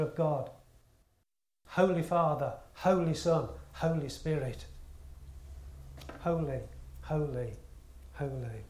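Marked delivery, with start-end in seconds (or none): 6.2-8.6 reverent hush. Acted. Reverent echo of angels' praise.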